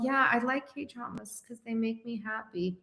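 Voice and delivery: said very softly and gently; soft sincere demo. Shown as a demonstration. Coach showing a client how to say it softly. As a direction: speak it softly, simply sincere. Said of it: "when you say it like this".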